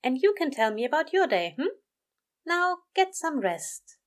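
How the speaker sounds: high, kindly coaxing; coaxing angel. A narrator's reading. Aziraphale tells drowsy Crowley to rest, kindly. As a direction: lift the pitch, slowing to send them to sleep.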